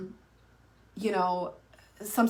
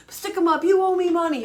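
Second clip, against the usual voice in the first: high, loud mock robber; hammed-up bandit demanding cash; mother playing robber in a squirt gun war, high and loud; playful stick-up; 8 semitones higher, 5 dB louder than usual. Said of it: "it was really funny".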